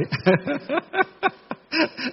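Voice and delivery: high, rapid hitching mock sobs; demonstrated choked weeping. Shown as a demonstration. Monk acting a little for the elders, choked mock sobs. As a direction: pretend to weep as if bitterly wronged. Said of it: "gọi là khóc tức tưởi".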